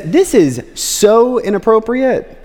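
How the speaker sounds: high-pitched, scandalized mock outrage; indignant protest. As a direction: act out an appalled dinner host scolding a guest. Simon confronts Jesus at dinner.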